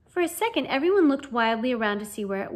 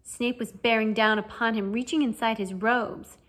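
ordinary delivery

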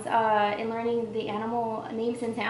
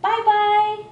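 sing-song coaxing; 9 semitones higher, 7 dB louder, much slower than usual. Acted. Coaxing toddler to say bye.

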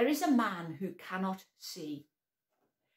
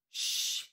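unvoiced hushing shh. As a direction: shush him. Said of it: "everyone said".

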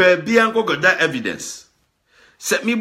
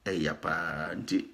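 quiet; 12 semitones lower, 14 dB quieter, much slower than usual. Real, not acted. Voice drops very low.

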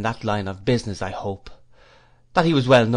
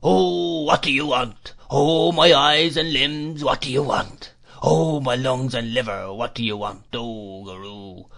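fierce monotonous whine; 2 semitones higher than usual. A narrator's reading. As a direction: whine it in a singsong, fading on the last word.

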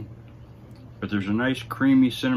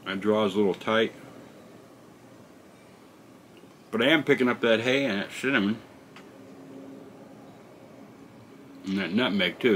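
talking around a cigar; cigar in mouth. Real, not acted. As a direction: lips closed on cigar. Seen 0: Cigar held at lips throughout, briefly removed near 3.8-4.1 s.

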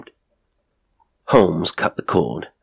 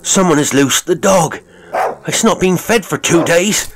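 very high, loud, urgent alarm; panicked cry; 13 semitones higher, 5 dB louder than usual. A narrator's reading. Elderly servant warning that the dog is loose, panicked cry. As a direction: shout for help, climbing higher with urgency. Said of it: "he cried".

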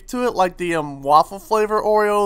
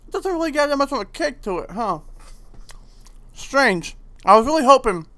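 muffled mouth full of cookie; chewing between words; talking while chewing; 4 semitones higher than usual. Real, not acted. Seen 0: bites the cookie at -1.0, then jaw works mid-speech, mouth half-closed.

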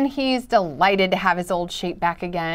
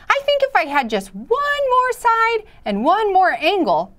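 high, wistful little shape voice; wishful triangle voice; 11 semitones higher, 3 dB louder than usual. Acted. Triangle voiced wishing for more.

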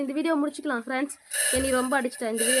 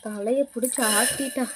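normal talking voice

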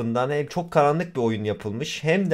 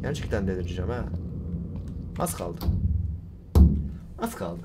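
low, quiet, worn-out murmur; subdued muttering; 7 semitones lower, 7 dB quieter than usual. Real, not acted. Gamer sneaking on after losing his patience, subdued. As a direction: mutter under your breath at low pitch.

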